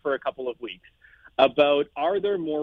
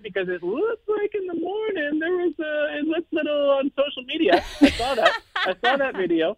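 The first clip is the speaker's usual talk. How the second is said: laughing teasing; 12 semitones higher than usual. Real, not acted. Teasing about party video.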